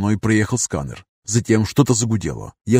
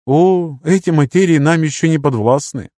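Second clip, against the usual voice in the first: a touch higher, polite elder figure; gently lifted, courteous mentor; 6 semitones higher, 3 dB louder than usual. Acted. Lirius speaking.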